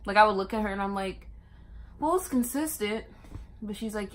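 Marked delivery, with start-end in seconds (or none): 2.0-3.0 mock matter-of-fact. Retelling labor, quoting herself.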